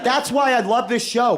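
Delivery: ordinary talk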